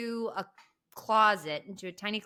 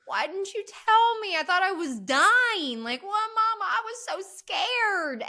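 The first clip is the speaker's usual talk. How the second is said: high, louder imitation of a crying, scared girl, slowing at the end; frightened Carrie; 12 semitones higher, 6 dB louder than usual. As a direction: act out a terrified daughter begging her mother. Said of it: "Carrie's just crying on the floor".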